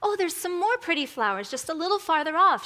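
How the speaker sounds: a hint of little-girl wonder; little girl thinking aloud. A narrator's reading. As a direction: lightly voice a girl noticing something lovely. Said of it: "she thought".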